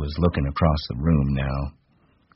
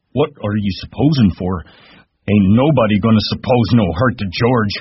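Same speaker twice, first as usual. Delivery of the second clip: higher and louder, threatening; angry challenge; 6 semitones higher, 6 dB louder than usual. A narrator's reading. Lenny threatening Crooks, angry and loud. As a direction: warn him off.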